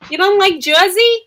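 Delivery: put-on light Jersey accent. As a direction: tough Jersey talk. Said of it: "that last part wasn't really Jersey".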